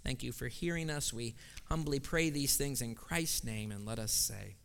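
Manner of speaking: soft, hushed prayer voice; hushed pastoral prayer. Real, not acted. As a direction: pray quietly and humbly before the congregation. Pastor leads congregational prayer.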